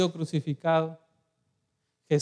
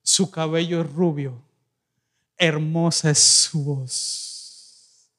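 tender reading; 5 semitones lower, much slower than usual. Acted. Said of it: "fíjese cómo habla una persona enamorada".

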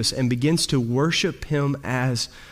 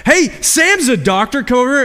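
raised high, louder call across a crash scene; calling out for help; 8 semitones higher, 6 dB louder than usual. Retold or imitated. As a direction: shout over to the doctor. Bystander calls doctor at wreck.

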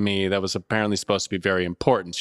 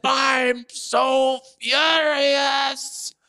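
very high, drawn-out mock shriek; hammed-up screaming fury; imitated screaming tenant; 14 semitones higher, much slower than usual. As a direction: wail it slowly, mimicking an outraged caller.

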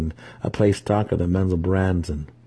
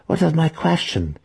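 much higher, bold objection; daring protest; 10 semitones higher than usual. A narrator's reading. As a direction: dare to push back. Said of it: "Malice dared to protest".

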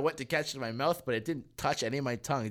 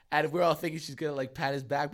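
normal talking voice

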